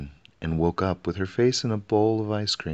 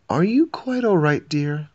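much higher, louder worried question; concerned queen; 10 semitones higher, 6 dB louder than usual. A narrator's reading. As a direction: fuss over him as his wife.

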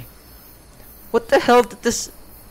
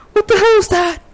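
startled cry; 10 semitones higher, 4 dB louder than usual. Real, not acted. Something in the dark.

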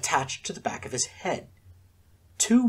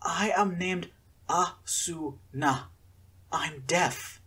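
slow, halting, poor English; 4 semitones higher, 4 dB quieter, much slower than usual. A narrator's reading. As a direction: struggle to form the words. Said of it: "very poor, halting English".

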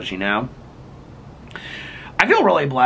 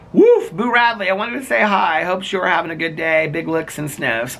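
higher-pitched, playful voice for a dog; dog's message; 6 semitones higher than usual. Acted. Reading a viewer's comment from their dog, high playful dog voice. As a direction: read it out high as the dog, slowing at the sign-off. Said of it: "that was her dog that said that".

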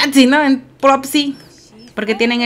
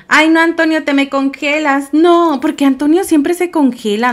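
higher, louder protest; exasperated scolding; 6 semitones higher, 6 dB louder than usual. Real, not acted. Player scolding a character who keeps freezing, loud exasperation. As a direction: complain in dismay at someone hopeless.

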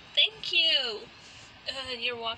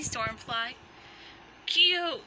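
ordinary delivery

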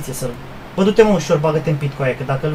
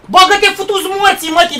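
angry yell; 6 semitones higher, 5 dB louder than usual. Real, not acted. Gamer yelling at teammate to get in. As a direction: bark it at him.

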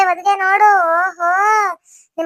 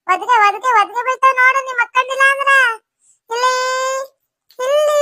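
put-on playful baby talk; 5 semitones higher than usual. Teasing and calling to kicking baby.

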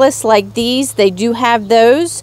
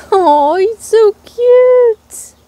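high, louder drawn-out gush; excited squeal; 11 semitones higher, 4 dB louder, slower than usual. Real, not acted. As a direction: melt over the little deer. Showing baby deer charm.

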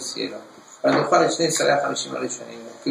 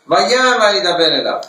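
loud proclamation; 7 semitones higher, 8 dB louder, much slower than usual. Shown as a demonstration. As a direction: ring out each vaya loud and high. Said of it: "Vaya es en voz alta". (en voz alta, proclamando)

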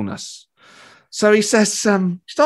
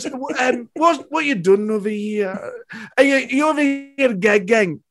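high, drawn-out imitation of a famous comedian; 8 semitones higher, slower than usual. Famous comedian quizzing two fans abroad, voice imitated. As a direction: ask it slowly, in his put-on voice. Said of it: "He goes".

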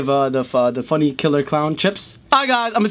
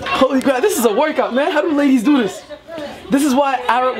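raised, straining workout exclamations; excited; 6 semitones higher than usual. Real, not acted. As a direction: exclaim it with effort. Shaking the pan, tiring.

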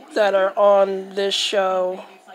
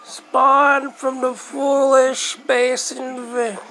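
announcer voice; 5 semitones higher than usual. Acted. Dramatic intro for game, retaken.

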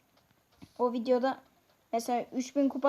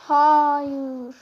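a long drawn-out no, loud; dismayed cry; 3 semitones higher, 8 dB louder than usual. Real, not acted. Gamer groaning at his team's mistake, drawn-out loud no. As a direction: cry out as the round slips away. Teammate blunder in match.

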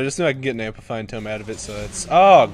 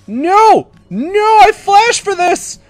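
high, loud wail of frustration; anguished protest; 10 dB louder, much slower than usual. Real, not acted. Gamer losing the kill he flashed for, loud wail. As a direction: cry out as the prize slips away.

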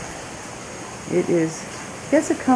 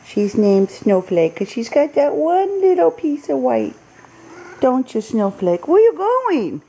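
higher sing-song voice for the cat; pet talk; 7 semitones higher than usual. Real, not acted. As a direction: coo at the kitty. Cooing to the cat Snowflake.

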